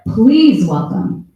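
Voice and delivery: loud, slow stage introduction; announcer introduction. Real, not acted. Emcee bringing the first poet on stage, loud announcement. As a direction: project the name out to the crowd, drawn out.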